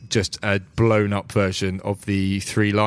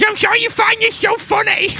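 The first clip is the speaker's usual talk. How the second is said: much higher, louder offended squeak; indignant gnome; 5 dB louder than usual. Acted. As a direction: scold the giggling player in a huff. Gnome annoyed at laughing players.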